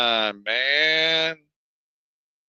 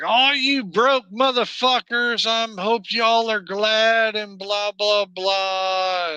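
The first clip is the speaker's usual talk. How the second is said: higher, louder whiny mock of a crying TikToker; mocking crying woman; 7 semitones higher, 3 dB louder than usual. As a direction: parody her wailing rant, pitched up. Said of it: "she's crying her eyes out".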